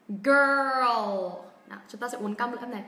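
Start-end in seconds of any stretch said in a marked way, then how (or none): 0.1-1.3 put-on Girl stretched slow and loud with a curled tongue; slow-motion Girl. Shown as a demonstration. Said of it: "mình tua chậm nhá".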